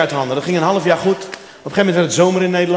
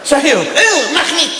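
very high, louder mock scold; scolding cleaner; 10 semitones higher, 3 dB louder, much slower than usual. Cleaner scolding after kiss. (heel hoog, gespeeld verwijtend)

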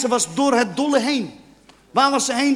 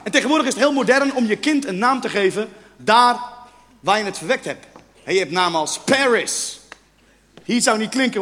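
ordinary talk

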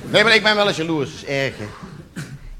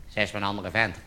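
much lower and much softer, a sly voice at the back of the head; little inner voice; 12 semitones lower, 12 dB quieter than usual. Acted. A jealous man hears a little voice in his head, murmured low. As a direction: murmur it like a nagging thought. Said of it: "zo'n stemmetje in je achterhoofd".

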